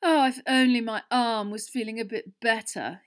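loud, put-on wistful lament; mock sighing. A narrator's reading. Smug Malfoy lamenting his injured arm, mock sighing. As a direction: sigh it theatrically. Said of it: "he sighed".

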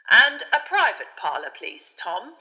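pointed. A narrator's reading. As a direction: make the hint unmistakable. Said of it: "said Fudge pointedly".

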